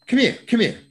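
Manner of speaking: retold director's summons, high and loud; calling over. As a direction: beckon him over urgently.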